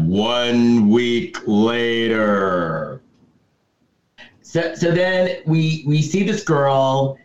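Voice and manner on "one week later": slow, drawn-out caption voice; title-card announcer. Acted. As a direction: stretch it out like a mock film narrator. Reads out film's title card.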